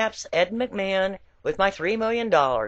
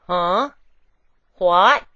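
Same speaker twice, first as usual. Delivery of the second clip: mock-baffled, the second word louder; baffled huh; 6 dB louder than usual. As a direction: play the dumbstruck visitors, blurting it out. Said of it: "they reply eloquently".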